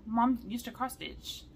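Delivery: normal talking voice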